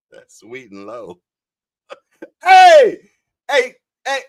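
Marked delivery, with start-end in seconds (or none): 2.4-4.2 high, loud shouts of protest; calling out. Real, not acted. Streamer stopping the chat in its tracks, shouting. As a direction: shout to cut someone off.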